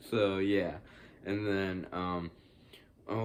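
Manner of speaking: ordinary delivery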